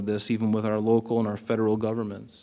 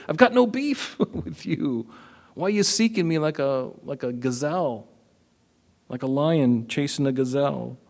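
casual modern paraphrase; 2 semitones higher than usual. Acted. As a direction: chat with Saul like a buddy, laughing. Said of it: "To put it in the vernacular of today".

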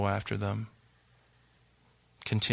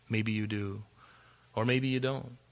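ordinary talk

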